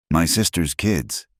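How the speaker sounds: usual speaking voice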